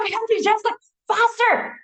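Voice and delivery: high, loud exasperated outburst; frustrated pleading to a too-gentle trainer; exasperated plea. Retold or imitated. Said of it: "And I was like".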